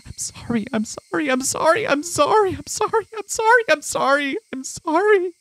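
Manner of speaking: high, desperate begging, rising; frightened boy pleading with the cicadas; panicked pleading. Acted. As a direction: beg over and over, pitch climbing into hysteria. Frantic repeated apologies.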